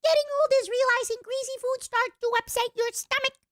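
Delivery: squeaky voice. Acted. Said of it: "this voice".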